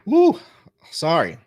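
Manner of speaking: coughing, gasping for breath; recovering from swallowing wrong; choking on a drink. Real, not acted. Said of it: "choking on some water".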